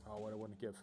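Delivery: ordinary talk, nothing marked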